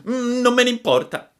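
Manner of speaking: very high, loud sulky retort; defiant puppet. A narrator's reading. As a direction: refuse loudly like a stubborn child. Defies the fairy's warning. (burattino testardo, strillo acuto)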